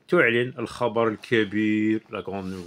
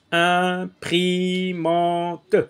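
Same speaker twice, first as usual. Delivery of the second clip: very slow, each syllable drawn out and a little higher; "Imprimante" sounded out syllable by syllable; syllables stretched out; 5 semitones higher, much slower than usual. Shown as a demonstration. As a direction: spell out "Imprimante" by sound for the children, very slowly. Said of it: "où est-ce que j'entends le son un".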